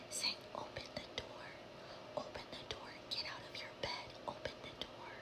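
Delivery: hushed, insistent spirit whisper. Acted. Spirits urge a child out of bed, whispering. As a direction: whisper it like voices in the dark. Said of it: "Whispers like this".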